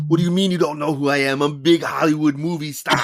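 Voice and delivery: mock-indignant Arnold, half laughing; Arnold Schwarzenegger impression. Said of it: "You do it very well".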